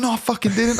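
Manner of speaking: high-pitched mock protest; acted denial. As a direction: play the mate denying it indignantly. Acts out mate's denial.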